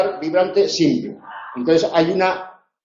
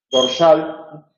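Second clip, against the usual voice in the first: normal talking voice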